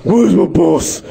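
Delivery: slow, over-the-top superhero growl; hammed-up menacing interrogation; Batman. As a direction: growl the demand like a masked vigilante. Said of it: "My, my Batman?".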